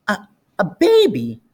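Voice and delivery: put-on a touch of annoyed boy, stammering; mildly put-out kid; annoyed child. A narrator's reading.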